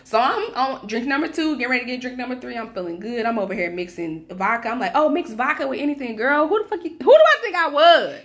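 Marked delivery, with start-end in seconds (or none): 4.9-8.1 tipsy, loud, pitched up bragging; yelling drunk at the bar. Retold or imitated. Drunk at the bar, bragging loudly to her friend.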